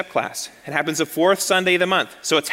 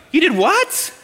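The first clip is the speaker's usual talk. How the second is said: "You did what?" much higher and louder, laughing, rising on "what"; outraged disbelief; 10 semitones higher, 4 dB louder, slower than usual. Retold or imitated. Wife repeating it louder, laughing in disbelief. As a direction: top the first take, laughing in disbelief. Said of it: "What do you think your wife's gonna say?".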